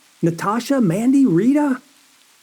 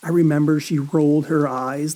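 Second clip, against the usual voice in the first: usual speaking voice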